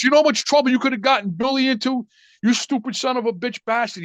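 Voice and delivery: high-pitched angry scolding, mildly acted; mock yelling, pitched well up; retold screaming tirade. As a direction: imitate a furious uncle telling you off. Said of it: "He's screaming and yelling at me".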